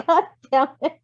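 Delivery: ordinary talk, nothing marked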